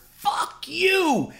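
sudden very high yelled curse; shouted insult. Retold or imitated. Heckler yelling at the manager before throwing a bottle, sudden high shout. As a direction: fling it from the back row. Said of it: "and I heard".

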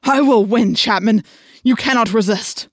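loud, triumphant command; thundering, emphatic villain voice; forceful declaration. A narrator's reading. The Yeerk overpowering Chapman, loud and forceful.

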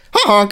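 high honking horn noise; honk censor. Pretend. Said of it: "Should I get the honk?".

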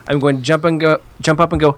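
usual speaking voice

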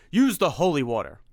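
loud choked cry; strained urgent cry; strangled cry. Acted. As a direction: force it out through the strain. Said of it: "he said in a strangled cry".

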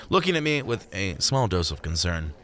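plain reading voice

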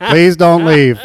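drawn-out laughing plea; mock philosopher quote. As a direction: pronounce it like a grand maxim, laughing. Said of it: "As Kierkegaard would say".

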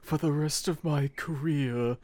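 lightly voiced ghostly old butler; Eddie Redmayne ghost voice. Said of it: "the voice he does in that movie where he talks like this".

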